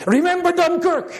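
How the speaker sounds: high rallying shout of a battle cry; a war cry, ringing high. Retold or imitated. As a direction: call it out like soldiers rallying. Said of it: "That became their battle cry".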